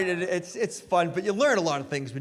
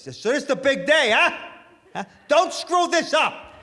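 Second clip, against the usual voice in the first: gruff dad warning; 9 semitones higher, 5 dB louder than usual. Retold or imitated. Dad to son before the wedding, loud mock warning. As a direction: bark it like a stern father. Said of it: "He says, just like this".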